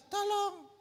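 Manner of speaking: a weak little call for help; the feeble plea the crowd did not use; timid cry. Shown as a demonstration. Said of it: "bukan lagi".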